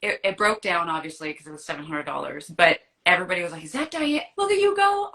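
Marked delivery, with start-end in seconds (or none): 3.6-5.1 high, loud mock-amazed cheer; excited, high-pitched impression of onlookers. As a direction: imitate friends hollering as she rides by. Voicing amazed onlookers in a story.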